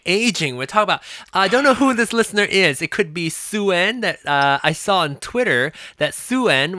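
usual speaking voice

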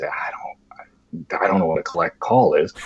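put-on whispered, timid kid's voice; small whisper. Scared boy admitting to the operator he doesn't know, whispering. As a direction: barely breathe it, unsure and scared. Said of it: "And I say".

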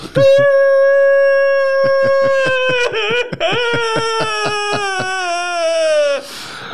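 very high, long sustained wail; piercing hammed-up crying fit; mock conniption. Shown as a demonstration. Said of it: "and then you've got conniption".

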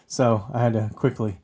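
usual speaking voice

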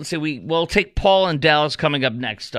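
ordinary delivery

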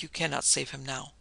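ordinary delivery, nothing marked

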